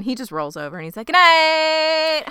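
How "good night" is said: loud, high singsong sign-off; mock breezy goodnight. Son rolls over from mom, mock singsong goodnight. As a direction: call it out in a chirpy singsong.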